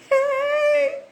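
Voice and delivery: high, loud, drawn-out hey; calling out. Acted. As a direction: sing out a hey to get everyone's attention. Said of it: "You are ready to say".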